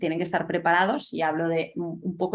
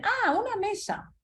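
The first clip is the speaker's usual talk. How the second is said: put-on "Ah, una mesa" in a much higher, slow child voice; child discovering the word. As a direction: act a child delighted to recognise mesa.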